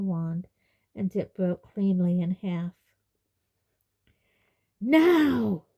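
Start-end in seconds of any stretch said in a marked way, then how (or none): 4.8-5.6 loud, raised single-word roar. A narrator's reading. Centaur roaring in Harry's ear, loud cry. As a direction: roar it out higher and long. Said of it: "roared a voice in Harry's ear".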